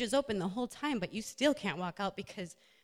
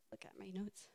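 whispered aside, much softer; whisper to self; 15 dB quieter than usual. Real, not acted. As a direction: murmur it to yourself while checking notes. Aside while checking her notes.

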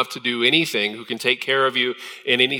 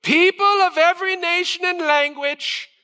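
high-pitched loud herald's cry. Acted. Herald proclaiming to all nations, high ringing proclamation. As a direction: proclaim it like a town crier, pitched way up. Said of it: "a herald loudly proclaimed".

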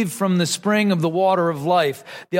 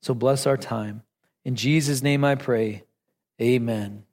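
hushed closing prayer, lower and softer; 6 semitones lower, 3 dB quieter than usual. Acted. Pastor closing a prayer before the sermon, hushed and low. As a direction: pray it quietly to end the prayer.

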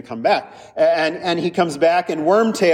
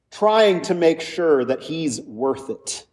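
usual speaking voice

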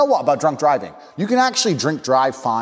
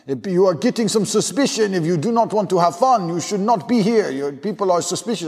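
high, suspicious mock French accent; French party host; 6 semitones higher than usual. French host accusing a guest who won't join in, mock French accent. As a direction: warn him in a pitched-up French accent. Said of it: "some French prick".

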